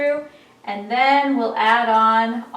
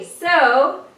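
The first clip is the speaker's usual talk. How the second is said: ordinary delivery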